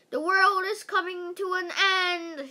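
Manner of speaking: high, loud doomsday shout. A narrator's reading. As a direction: shout it like a panicking schoolboy. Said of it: "shouted Michael".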